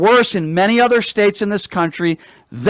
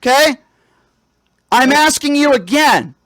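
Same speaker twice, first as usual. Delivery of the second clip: loud sharp voice; 4 semitones higher than usual. Real, not acted. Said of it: "why are you yelling?".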